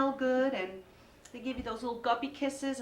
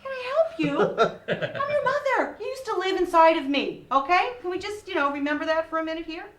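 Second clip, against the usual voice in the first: mock indignant mom; 8 semitones higher, 8 dB louder than usual. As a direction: fire back at the snotty teen, hammed-up. Mother scolding teen daughter.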